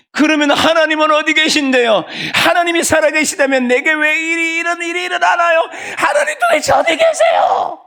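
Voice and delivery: high anguished shout, climbing to a scream; lamenting, resentful outcry. Acted. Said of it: "하나님을 향하여 한탄하는 소리, 원망하는 소리".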